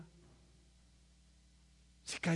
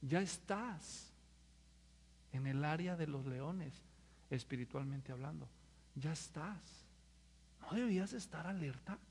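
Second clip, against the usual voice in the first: hushed warning; 5 semitones lower, 11 dB quieter than usual. Real, not acted. Preacher warns they're already among lions, hushed. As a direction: lean in and warn quietly. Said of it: "hushed solemn warning".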